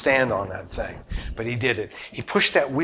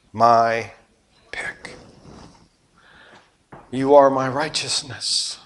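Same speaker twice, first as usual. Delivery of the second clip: tender voice of Jesus; 3 dB quieter than usual. Retold or imitated.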